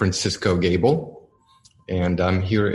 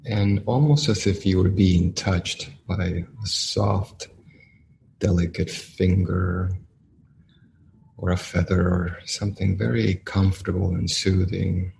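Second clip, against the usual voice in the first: guided meditation. Acted. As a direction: ease the listeners into stillness, one phrase at a time.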